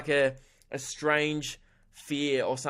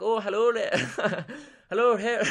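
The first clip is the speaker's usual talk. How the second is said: high Irish leprechaun voice, breaking into laughter; bad Irish accent; 7 semitones higher than usual. Likable leprechaun saying hello, bad Irish accent. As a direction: do a terrible Irish accent and crack up. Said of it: "how shit my Irish accent would be".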